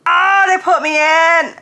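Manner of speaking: high, loud and slow with delight; thrilled disbelief. Real, not acted. Fan sees herself in the video, thrilled high cry. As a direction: cry out in happy shock.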